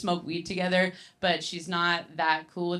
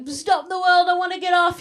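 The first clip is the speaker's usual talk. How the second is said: panicked dad; 9 semitones higher, 6 dB louder than usual. Retold or imitated. Dad panicking while too high.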